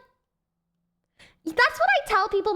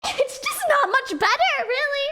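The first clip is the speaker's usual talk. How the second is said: mock indignant; 11 semitones higher than usual. Real, not acted. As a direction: squeak it out in fake outrage. Joking complaint about ten-year-old.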